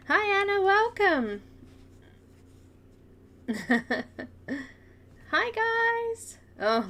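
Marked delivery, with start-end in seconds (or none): none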